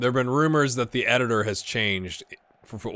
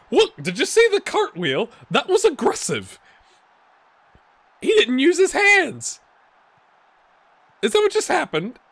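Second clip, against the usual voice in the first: higher and louder, amazed outcry; excited disbelief; 13 semitones higher, 6 dB louder than usual. Real, not acted. Vlogger watching a striker cartwheel, excited disbelieving shout. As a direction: cry out at what you just saw.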